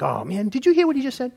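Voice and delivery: mildly stunned exclamation; a touch of incredulity; mock shock. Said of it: "I'm like".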